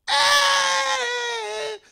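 a sudden piercing high yell; high shouted cry. Acted. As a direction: cry out loud and high like a shocked judge. Mocking a bad singer.

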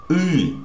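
Y as a hard vowel, high then falling; Russian letter sound. Shown as a demonstration. Teacher introducing a forgotten letter, hard high Y sound. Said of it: "which is the letter".

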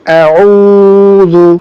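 slow, level, sustained middle vowel in "আউযু"; stretched reading. Shown as a demonstration. Teacher reading through for students, "উ" drawn out. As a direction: hold "উ" long in "আউযু", then short "যু". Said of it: "টানা পড়ে চলে যাবো".

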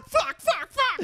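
very high cackling swear; laughing squeal. Real, not acted.

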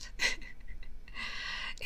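quiet voiceless chuckle; a tiny, almost silent laugh; hushed giggle. Acted. Recalling the hidden camera, a hushed voiceless giggle.

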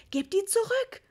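high, loud pleading mum; frantic mother's yell; desperate shout. A narrator's reading. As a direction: cry the order in despair. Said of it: "schrie Mama verzweifelt".

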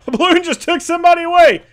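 high, loud, frantic yell; panicked report. Acted. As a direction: cry out in acted disbelief and alarm. Voices panicked guard reporting balloon.

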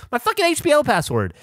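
mock-indignant self quote; retold frustration. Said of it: "And I go".

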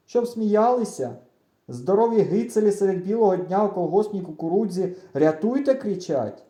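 loud, mocking scoff at the idea; scornful retort. A narrator's reading. Friend scoffing at the plan to yell for help, mocking.

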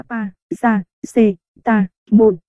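"pa, ra, c, ta, môn" in five detached syllables; syllable-by-syllable reading. Shown as a demonstration. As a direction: sound out "pa, ra, c, ta, môn" as pa-ra-xê-ta-môn. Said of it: "paracetamol đọc là". (đọc "pa, ra, c, ta, môn" tách từng âm tiết)

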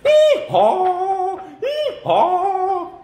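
very high, drawn-out hee-haw; zebra bray. Shown as a demonstration. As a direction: bray like a donkey to imitate a zebra. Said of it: "makes a sound like this".

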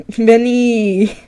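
mock Italian accent, stretching "Veni-". Streamer imitating the character saying its own name, Italian accent. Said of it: "in their Italian accent".

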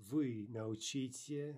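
ordinary delivery

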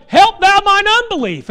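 high, loud cry for help; tearful plea. Retold or imitated. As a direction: cry it out through tears. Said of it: "saying it with tears in his eyes".

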